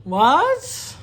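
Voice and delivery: high, loud disbelief; can't believe it; surprised. Real, not acted. Shocked reaction to the rule.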